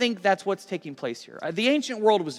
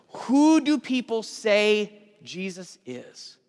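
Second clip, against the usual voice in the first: slow, weighty question; 3 semitones higher, much slower than usual. Acted. Jesus questions his disciples.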